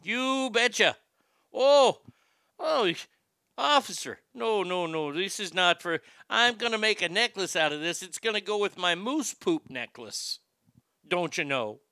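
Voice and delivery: put-on light Minnesota accent, caught at customs; playful hint of a Minnesota accent, pleading with the officer. Caught at customs pleading with officer, mock Minnesota accent. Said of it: "went Canadian".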